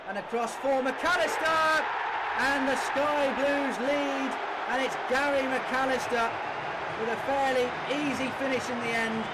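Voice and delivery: the ball's in, call it to the whole ground; goal call. Real, not acted. Goal scored for Sky Blues.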